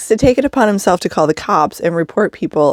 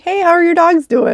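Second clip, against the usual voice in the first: friendly cop greeting; 11 semitones higher than usual. Retold or imitated.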